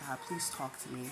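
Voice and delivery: softly pleading to God; hushed prayer. Retold or imitated. She recalls praying in the dark, asking God to speak, in a soft hushed plea. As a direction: murmur the plea low and soft.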